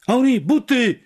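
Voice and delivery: high, loud call after someone; parting shout. A narrator's reading. Narrator shouting to Henri leaving the block, parting shout. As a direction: shout it out to a departing friend. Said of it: "krzyknąłem na pożegnanie".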